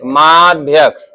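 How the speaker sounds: माध्यक drawn out very slowly and low; slow teaching repeat. Shown as a demonstration. Teacher drilling pupils on the word, slow and low. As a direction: stretch each sound of माध्यक for pupils. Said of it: "माध्यक बोलते हैं".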